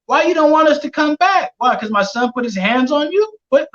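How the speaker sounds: high, incredulous questioning; indignant challenge. Retold or imitated. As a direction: push back with disbelieving questions.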